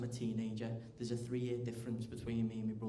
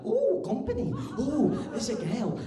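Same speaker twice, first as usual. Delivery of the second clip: high, loud, overexcited whooping; all excited; 11 semitones higher, 8 dB louder, slower than usual. Acted. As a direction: gush in delight at unexpected company. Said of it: "I just get all excited".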